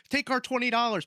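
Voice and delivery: eager fans. Retold or imitated.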